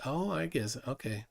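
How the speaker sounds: normal talking voice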